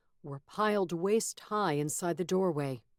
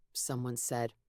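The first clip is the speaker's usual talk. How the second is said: plain reading voice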